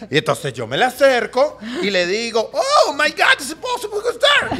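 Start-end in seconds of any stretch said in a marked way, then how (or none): 2.5-4.6 high, loud shrieked gossip impression; hammed-up shriek in accented English; Spanglish gossip. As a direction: squeal it like scandalized gossip. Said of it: "lo mejor del chisme te lo tira en inglés".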